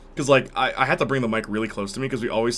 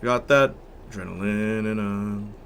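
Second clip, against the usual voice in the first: same line an octave lower. Shown as a demonstration. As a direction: sing it down in the low octave. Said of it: "He's doing octaves".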